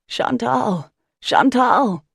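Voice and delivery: loud calling bellow. Acted. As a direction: bellow her name across water. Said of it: "Jonah bellows".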